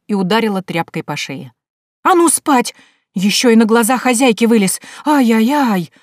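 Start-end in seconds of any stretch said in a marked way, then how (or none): 2.0-5.9 high, loud nagging scold; angry scolding. Acted. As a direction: chase him off to bed, yelling. Akulina hits him with rag.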